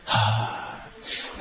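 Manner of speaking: a quick puff of air, no voice; short soft exhale. Shown as a demonstration. Teacher showing short relaxed out-breaths, softly. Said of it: "今度は短い音で".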